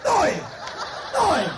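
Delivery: high, punchy mock cries; Jocko's Oi. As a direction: bark out each Oi with a laugh.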